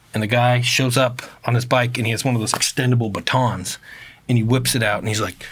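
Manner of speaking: ordinary talk, nothing marked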